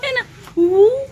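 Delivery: loud, high, excited shout; excited call. Real, not acted. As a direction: cheer her on brightly. Excited calling to baby.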